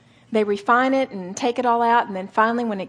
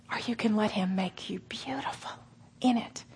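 whisper; 8 dB quieter than usual. Real, not acted. Tender appeal to the women.

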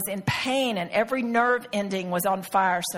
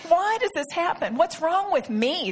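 higher-pitched, upset questioning; retold anguish, pitched up; tearful self-questioning; 6 semitones higher than usual. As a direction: plead with yourself, holding back tears. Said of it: "trying to keep the tears back".

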